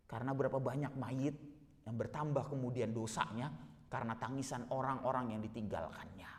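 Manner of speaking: ordinary talk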